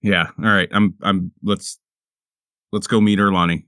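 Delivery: ordinary delivery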